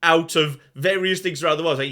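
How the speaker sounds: ordinary talk, nothing marked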